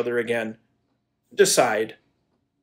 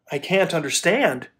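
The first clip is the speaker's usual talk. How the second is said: ordinary delivery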